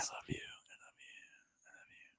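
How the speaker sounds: barely audible, drawn-out whisper; whispered devotion. Acted. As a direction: let the love fade into breath. Lovestruck skit, fading declaration.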